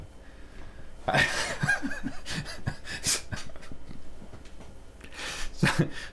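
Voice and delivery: high breathless giggle; can't hold back the giggle; giggling laugh. Real, not acted. Streamer giggling after teasing Emanuele with a long laugh. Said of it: "Sorry, I couldn't resist".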